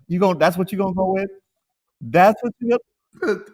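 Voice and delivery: mock incredulous, pitched well up; retold disbelief. Retelling his reply to the friend's cologne excuse, mock incredulous.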